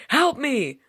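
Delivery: veel hoger en luider gillen; scream for help. Acted. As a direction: gil het uit in paniek. Victim screams on the call.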